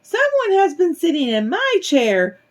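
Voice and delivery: high, tiny little bear voice; squeaky-high baby bear. A narrator's reading. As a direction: cry it out as a little cub, high-pitched. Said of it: "cried in his tiny little voice".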